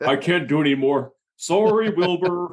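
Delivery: high, playful apology to a horse named Wilbur; mock sorry to a horse.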